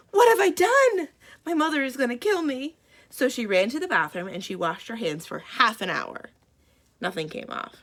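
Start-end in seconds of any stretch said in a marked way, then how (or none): 0.1-2.8 high, loud panicked cry, rushing. Acted. Bridget panicking at her colored face, high panic. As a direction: wail in alarm.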